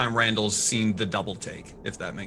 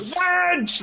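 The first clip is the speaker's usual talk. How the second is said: mock tavern-keeper yell, much higher; imitating a bellow. Player imitates the tavern keeper yelling for the wench. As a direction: call out like the barkeep. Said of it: "this tavern leader".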